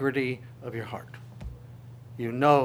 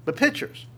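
"pitchers" soaring high; stress on pitchers; 12 semitones higher than usual. Shown as a demonstration. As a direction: make "pitchers" sound huge. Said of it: "not just a little bit".

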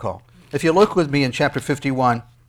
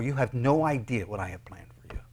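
secret whisper; 4 semitones lower, 7 dB quieter than usual. Acted. God's secret promise whispered.

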